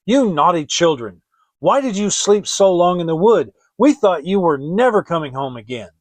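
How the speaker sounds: loud scolding stepmother. Acted. Stepmother scolds the children at the door, loudly. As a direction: snap at the children for coming home late. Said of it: "when the wife opened it... she said".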